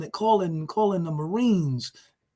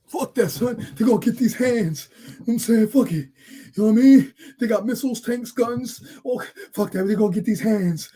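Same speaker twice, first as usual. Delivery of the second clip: tough guy bravado; 4 semitones higher, 4 dB louder than usual. Retold or imitated. Imitating tough street talk.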